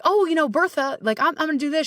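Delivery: high, bubbly telling of big plans; excited announcement. Retold or imitated. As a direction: pitch way up, eager to share with Bertha. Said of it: "I'm like".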